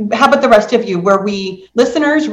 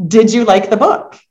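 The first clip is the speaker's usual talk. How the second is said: normal talking voice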